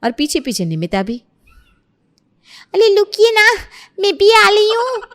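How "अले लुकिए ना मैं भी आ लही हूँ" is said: loud, high lisped call; squeaky bride shouting with a lisp. Acted. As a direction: call after husband in a babyish squeal. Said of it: "ये तोतली बहू".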